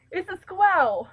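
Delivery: "squirrel" in a high, loud attempted English accent, R dropped. Shown as a demonstration. Player showing off an English accent, "squirrel" squashed to "skwel". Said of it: "You can't say squirrel".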